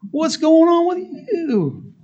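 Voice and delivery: high, slow, mocking drill instructor. Recruit imitating drill instructor, puzzled. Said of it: "He goes".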